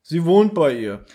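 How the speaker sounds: slow, drawn-out porter impersonation; imitating the porter. Host mimicking the porter explaining where Mrs. Fowler is, stilted. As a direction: stretch every word like a stilted radio-play actor. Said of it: "dem Fördner nachmachen".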